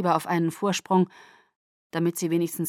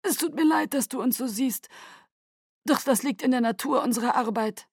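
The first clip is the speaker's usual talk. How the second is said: mock sorrow; 6 semitones higher than usual. A narrator's reading. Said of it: "sagte Aro betrübt".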